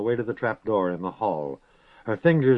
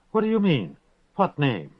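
pitched up sharply on the first question; startled demand; 5 semitones higher than usual. A narrator's reading. Girl pressing the prisoner, startled and demanding. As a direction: blurt it out, caught off guard.

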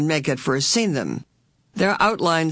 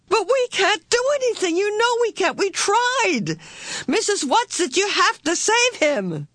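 very high, frantic protest; desperate pleading. A narrator's reading. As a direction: beg shrilly, as if there is no other hope. Meg desperately begs, voice shrill.

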